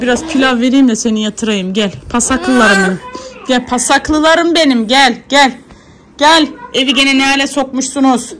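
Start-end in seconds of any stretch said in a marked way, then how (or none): 2.1-8.3 affectionate calling. Real, not acted. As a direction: call the little messes over fondly. Calling the messy kids. (çocuklarını yüksek sesle sevgiyle çağırma)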